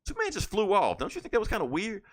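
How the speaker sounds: high, puzzled question; mock bewildered fire chief; puzzled deputy. Deputy puzzled to Batman about Superman leaving, high and baffled. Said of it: "the Deputy Moore was like".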